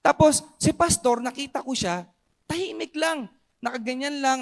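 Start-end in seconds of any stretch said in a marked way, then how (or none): none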